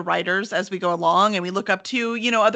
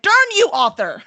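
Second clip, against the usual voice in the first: louder and higher, a laughing mock curse at the author; mock outrage; 9 semitones higher, 7 dB louder than usual. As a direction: shake a playful fist at the writer, laughing. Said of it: "And you're like".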